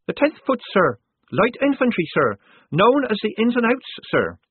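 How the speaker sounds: high eager recruit voice; nervous young recruit. Acted.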